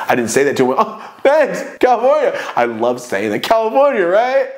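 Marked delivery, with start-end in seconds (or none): 0.8-2.3 high-pitched mock-friendly chirp; retold perky, teasing reply; cheeky chirp. Acts out his cheeky line. 3.4-4.5 high-pitched mock-friendly chirp; retold perky, teasing reply; cheeky chirp. Repeats the line he loves saying.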